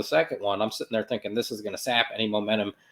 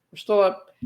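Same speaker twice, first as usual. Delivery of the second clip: much higher-pitched insistence; high, strained-optimist reassurance; anxious self-reassurance; 9 semitones higher, 3 dB louder than usual. Acted. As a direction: pitch jumps up, trying to convince yourself. Nervous self-reassurance after conceding.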